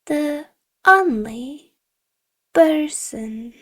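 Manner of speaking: drawn out slowly, each word weighted; stern teacher stretching out the only person; slow pointed words. Acted. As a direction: spell it out slowly to the accused boy. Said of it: "lowered her voice and said her next word slowly".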